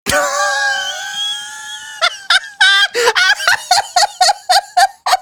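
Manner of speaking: long, high-pitched laughing fit. Real, not acted. Host breaks down laughing at own slip, laughing fit.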